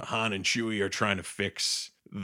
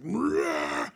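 a much higher mock monster roar; 14 semitones higher than usual. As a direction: jump out with a silly creature roar. Monster jumps out roaring.

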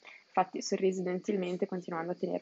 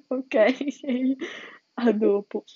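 loud, high, laughing mock of the girl; giddy hammed-up farewell, laughing; 4 semitones higher, 8 dB louder, much slower than usual. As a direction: ham up her giddy goodbye through a laugh.

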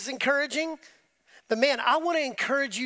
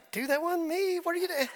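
mock protest; 6 semitones higher than usual. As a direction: plead innocence in a raised pitch. Acts himself accused by staring guard.

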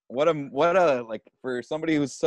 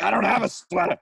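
higher, louder mock yell; 10 semitones higher, 4 dB louder than usual. Old star complaining to the bellhop, a mock yell. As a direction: raise a hammed-up fuss about the sweater. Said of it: "he's yelling about".

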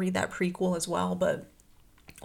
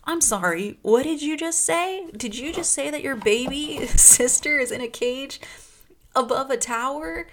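incredulous banker Po; 6 semitones higher, 4 dB louder than usual. Retold or imitated. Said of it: "Po is like".

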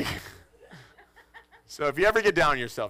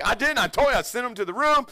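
high, loud, pleading innocence; defensive protest; 8 semitones higher, 5 dB louder than usual. Retold or imitated. Husband protesting to his wife, high and loud. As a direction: insist you did nothing wrong.

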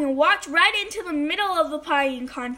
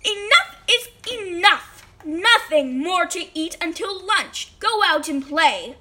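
mom yelling; 3 semitones higher, 4 dB louder than usual. A narrator's reading. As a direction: lay down the law as a fed-up mom. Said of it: "yelled his mom".

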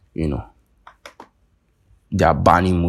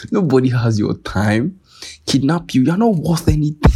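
rising higher and louder contemptuous mockery; mocking put-down; 9 semitones higher, 4 dB louder than usual. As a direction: sneer harder with every phrase. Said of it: "for not saying".

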